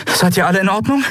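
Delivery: high voiced concerned question; Endriel asking. A narrator's reading. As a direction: check on everyone in a high voice. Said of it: "fragte Endriel".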